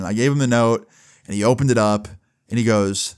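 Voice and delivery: ordinary delivery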